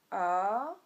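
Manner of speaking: "A" long and low, then climbing; dipping tone. Shown as a demonstration. Teacher for learners to repeat, "A" dipping then rising. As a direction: hold "A" down, then swing it up. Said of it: "prononcer après moi".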